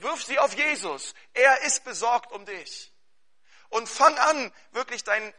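loud, high, driving sermon voice; fervent preaching. Acted. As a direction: preach it out with full force to the congregation. Preaching at full force.

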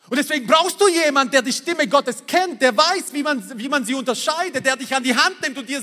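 high, driving sermon delivery; fervent preaching. Acted. Pastor urging the congregation toward closeness with God, fervent preaching. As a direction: preach it with rising fervour.

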